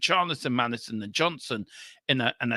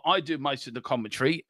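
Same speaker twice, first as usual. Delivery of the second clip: usual speaking voice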